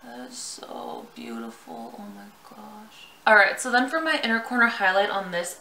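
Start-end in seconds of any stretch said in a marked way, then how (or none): 0.0-3.1 awed. Real, not acted. Admiring the sprayed shade.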